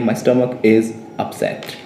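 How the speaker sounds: slow, evenly spaced English for learners; unhurried teacher's dictation; slow model sentence. Shown as a demonstration. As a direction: say it carefully, word by word.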